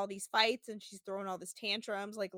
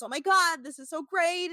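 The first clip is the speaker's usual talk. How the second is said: high, breathy swooning gush; 8 semitones higher, 4 dB louder than usual. Retold or imitated. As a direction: gush like she's in heaven. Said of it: "she's doing all this".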